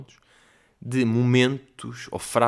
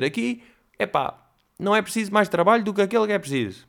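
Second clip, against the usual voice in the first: high-pitched folksy waiter; mock waiter saying; 9 semitones higher than usual. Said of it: "a olhar para a minha avó mesmo".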